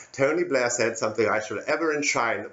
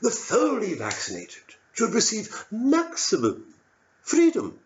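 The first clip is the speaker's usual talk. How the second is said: rapturous; 8 semitones higher than usual. Shown as a demonstration. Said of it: "came the answer".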